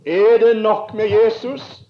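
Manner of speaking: loud, high, slow desperate call across the water; loud pleading cry from the sea; drowning man's cry. Retold or imitated. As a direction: call out over the waves like a drowning man. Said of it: "Og så roper han".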